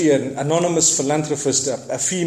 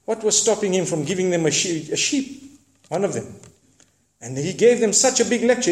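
usual speaking voice

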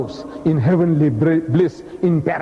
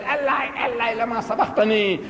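high, sustained mock lament; acted plea from the cross; crying out; 6 semitones higher, 3 dB quieter than usual. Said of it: "he cried".

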